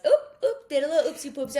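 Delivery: high, loud playful oops; flippant dad. Retold or imitated. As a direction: imitate a dad shrugging off a mistake, high-pitched. Voices dad's flippant reply.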